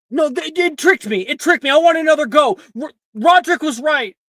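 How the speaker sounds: high, loud pleading complaint; loud protest. Acted. Student pleading with the professor, loud protest. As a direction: beg for another go.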